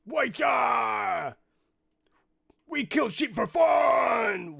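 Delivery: loud, high orc war cry; yelling orc. Acted. Orc yells back at the druid about the sheep, high drawn-out shout. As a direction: bellow it out like an orc, drawing out the last word. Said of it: "yelling something about a wah".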